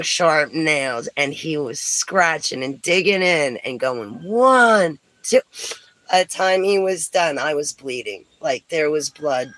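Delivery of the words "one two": drawn-out, louder, higher ONE; mock counting out. Woman imitating a classmate counting scratches, drawn-out mock count. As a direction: count like a kid digging in.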